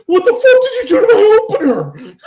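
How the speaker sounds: very high, loud outraged shriek; mock furious yell. Coworker yelling about his bent bottle opener, hammed-up outrage. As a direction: yell it like your friend just found his ruined opener. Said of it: "He's like".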